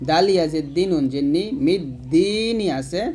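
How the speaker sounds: ordinary talk, nothing marked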